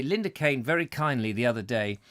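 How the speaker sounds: ordinary talk, nothing marked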